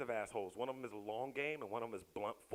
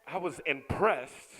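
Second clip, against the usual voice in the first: ordinary delivery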